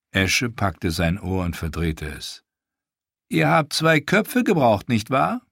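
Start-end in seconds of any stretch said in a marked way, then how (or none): 3.3-5.4 high, louder taunting repeat; mocking echo. Ash mocking Theon's confession, high and taunting. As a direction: throw his own words back at him.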